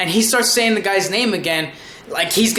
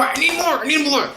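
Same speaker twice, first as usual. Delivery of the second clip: high, hammed-up pleading for more; desperate begging; 7 semitones higher than usual. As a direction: beg like a fan in mock desperation. Begs for more episodes, hammed up.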